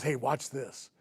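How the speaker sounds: quietly letting a coworker in on it; retold half-whisper. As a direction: say it low, like a discreet tip-off. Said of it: "kinda whispers to me".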